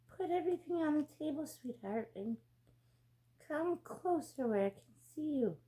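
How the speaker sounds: wolf coaxing in a put-on granny voice; wolf to the girl, mild granny disguise; wolf as grandma. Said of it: "in his best grandma voice".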